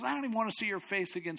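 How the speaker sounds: fast, high-pitched disgust; acted royal rage, rushed and high; angry dismissal. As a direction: spit it out quickly. King rejects Haman angrily.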